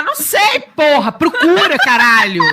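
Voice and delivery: loud, high retold yell, swearing; exasperated shouted reply. Woman imitating replies when people shout asking where things are, exasperated yell. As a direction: yell it like an irritated answer across the house. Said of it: "E daí grita gente pra saber".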